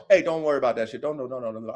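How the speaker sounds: acting a low, soft reassurance; hushed, low calming quote; calming them down. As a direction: drop right down and soothe a hothead. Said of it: "I tell my dudes who's ready to go".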